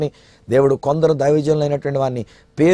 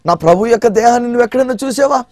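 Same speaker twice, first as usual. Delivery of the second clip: high, pleading woman's plea; pleading Mary; 10 semitones higher than usual. Acted. Mary begging the gardener for her Lord's body, high and pleading. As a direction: beg the gardener with rising urgency, pitched high. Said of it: "అడుగుతుంది".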